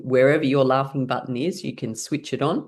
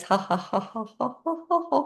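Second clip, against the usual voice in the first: ha-has climbing in pitch; light, rising exercise laugh; laughing sounds; 9 semitones higher, 3 dB quieter than usual. Shown as a demonstration. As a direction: fake laugh that lifts higher as it goes. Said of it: "as you're making laughing sounds".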